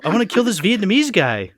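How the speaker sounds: high-pitched mock actor voice; Mark Wahlberg. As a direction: insist in a hammed-up celebrity impression. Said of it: "Mark Wahlberg would have only".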